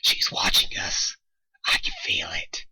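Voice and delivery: slow, paranoid whisper. A narrator's reading. Rainbow warning the others, whispered. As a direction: breathe it, scared.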